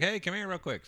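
high, eager call over; excited call. Retold or imitated. Calling to Erin over a garage-sale find, high and eager. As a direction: call her over with urgent glee.

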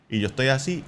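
ordinary delivery

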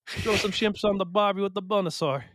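high, hammed-up Aussie twang; jokey put-on Australian voice; mock Australian accent. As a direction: play a cartoon Aussie at a barbecue. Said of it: "That's Australian".

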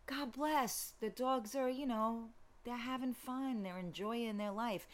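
mildly imitating a mellow buddy talking you down; soft, easygoing take on a calm friend; chill Jessie B. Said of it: "so that he could be all chill".

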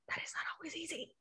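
whispered aside. Real, not acted. To her viewers, a whispered aside about being present. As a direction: drop to a hushed aside.

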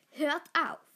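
loud, mildly stern order; stern growl. A narrator's reading. Pack leader tells the teasing young wolves off, stern. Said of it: "knurrte der Leitwolf streng".

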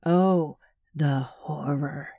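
slow, mocking, dropping to a whisper; sarcastic drawl. A narrator's reading. Neil mocking the woman's threat to haunt him, whispered sarcastic drawl. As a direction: sneer it out like fake fear. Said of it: "Neil drawled".

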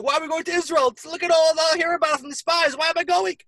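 high, loud whiny mock complaint; whiny complaining crowd. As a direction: pitch it way up and whine like a grumbling crowd. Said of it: "start complaining and crying".

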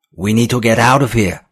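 high, loud urgent plea. A narrator's reading. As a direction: press them to flee now. Panicked escape plea.

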